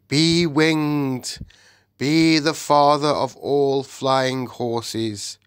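roaring lion. A narrator's reading. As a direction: proclaim it like a lion king. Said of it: "roared Aslan".

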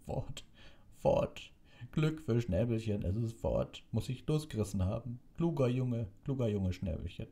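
high, tearful, overjoyed exclaiming; sobbing relief. A narrator's reading. Hagrid rejoicing that his creature got away, tearful. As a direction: cry it out through mock sobs. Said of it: "Worte durch sein Schluchzen".

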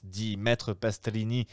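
usual speaking voice

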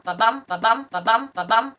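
every second syllable of "ba-bum" punched; iambic beat. Shown as a demonstration. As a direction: regular heartbeat rhythm on "ba-bum". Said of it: "So it will sound like ba-bum".

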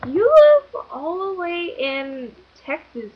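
high, drawn-out disbelief; incredulous objection. Retold or imitated. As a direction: stretch it out loudly in mock disbelief. Retells disbelieving objection to him.

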